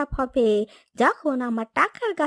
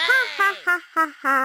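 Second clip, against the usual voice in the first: evil laugh. Acted. Child gloats over money tree.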